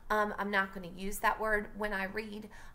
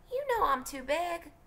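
put-on higher kid voice for Jem; Jem's line; 10 semitones higher than usual. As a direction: voice the big brother sulking, pitched up. Jem's line read aloud.

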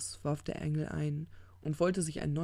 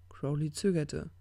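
plain reading voice

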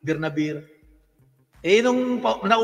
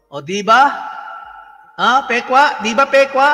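calling out; 3 semitones higher, 8 dB louder, slower than usual. Real, not acted. Calling out to listeners for agreement.

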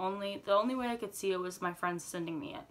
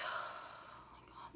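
slow, breathless whisper of frustration; exasperated whisper; 9 dB quieter, much slower than usual. Retold or imitated. Telling friends about the hack in a slow exasperated whisper. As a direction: drop to a slow hushed whisper.

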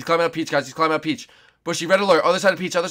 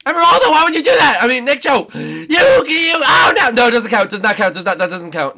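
much higher and louder, fast protest; whining furious shout; outraged yelling; 12 semitones higher, 12 dB louder than usual. Real, not acted. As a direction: shriek in disbelief at being pushed. Pushed off, protesting loudly.

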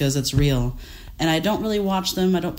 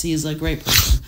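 ordinary delivery

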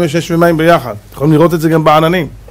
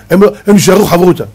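ordinary delivery

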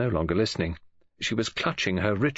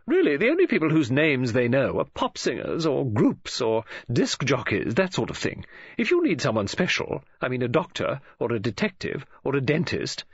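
higher-pitched, emphatic chatterer; opinionated woman friend; 6 semitones higher than usual. Acted. Woman friend explains word of mouth to the detective, emphatic. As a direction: rattle on, explaining as if it were obvious.

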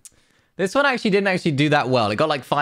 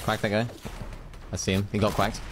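quiet gamer mutter; 5 semitones lower, 7 dB quieter than usual. Acted. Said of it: "The next time you're wearing a duck skin in Fortnite".

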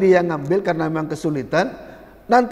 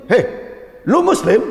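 rude challenge; 4 semitones higher than usual. Retold or imitated. Preacher acts a rude reminder, mock-aggressive. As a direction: challenge him like a street tough. Ini bahaya ini.

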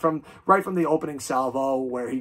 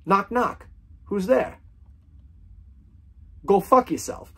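higher, hammed-up knock-knock delivery; mock joke voice; 8 semitones higher, 6 dB louder, slower than usual. Vlogger tells a rude knock-knock joke to viewers, hammed-up and higher. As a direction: play both sides of a silly joke, then the rude punchline.

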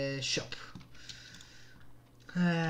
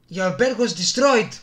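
mock game announcer; 6 semitones higher, 12 dB louder, slower than usual. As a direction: intone it like the game voice. (μίμηση εκφωνητή παιχνιδιού)

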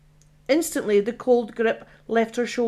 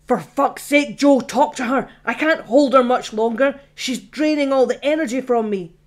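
louder, urgent cry while holding her back; desperate call to his friend, louder; straining shout; 3 semitones higher, 5 dB louder than usual. Acted. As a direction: shout for help under strain. Straining ghost shouts for help.

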